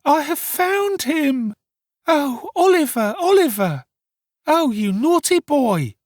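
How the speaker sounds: put-on high, drawn-out wailing young woman; hysterical exclamations. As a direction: lament loudly, as if overcome at finding him. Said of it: "With these incoherent exclamations".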